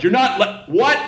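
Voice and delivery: imitated disbelief, rising high on what; a drunk who can't believe he's cut off; incredulous customer. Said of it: "he couldn't believe he'd been cut off".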